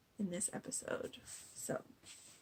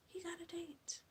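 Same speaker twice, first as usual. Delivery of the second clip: hushed, higher gossipy whisper; whisper aside; 8 semitones higher, 9 dB quieter than usual. Real, not acted. Vlogger whispering the date news to viewers. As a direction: lean in and whisper it like juicy gossip.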